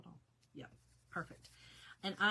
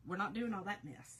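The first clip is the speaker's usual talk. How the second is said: ordinary talk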